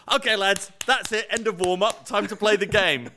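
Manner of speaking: high-pitched mock coach call; imitated coach. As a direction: imitate a coach rallying the lads. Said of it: "At the end of which he said".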